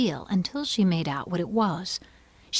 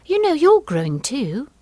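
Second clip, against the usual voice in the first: high, louder, bolder comeback; bold retort; 10 semitones higher, 6 dB louder than usual. A narrator's reading. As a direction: answer back boldly, pitched up. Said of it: "said Alice more boldly".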